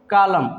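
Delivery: कॉलम ending on M; silent N. Shown as a demonstration. Teacher answers his question, कॉलम without N. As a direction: say कॉलम stopping at M. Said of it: "क्या पढ़ेंगे इसको".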